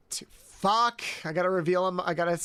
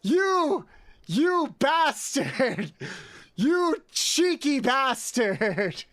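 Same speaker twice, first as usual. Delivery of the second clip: slow, drawn-out mock scolding; mock outrage; 5 semitones higher, much slower than usual. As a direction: fake fury at generous fans. Mock scolding generous gifters.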